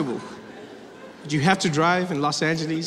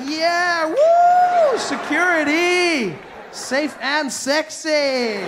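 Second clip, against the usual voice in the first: high, loud, drawn-out mock cheering; whooping delight; 9 semitones higher, 5 dB louder, much slower than usual. Passenger egged on by chanting, whooping excitedly. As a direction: cheer along like at a strip show. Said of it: "you'd be like".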